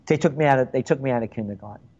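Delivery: ordinary delivery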